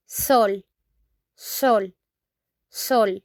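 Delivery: "sol" with a round, pure "o"; Spanish word drill. Shown as a demonstration. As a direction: repeat "sol" crisply for the learners. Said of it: "we say sol in Spanish".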